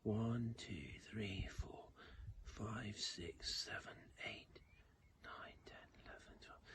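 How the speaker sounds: counting under the breath in a whisper. Shown as a demonstration. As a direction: whisper the numbers, fading away. Counting the other side quietly.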